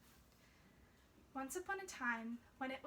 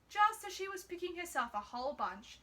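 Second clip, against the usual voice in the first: usual speaking voice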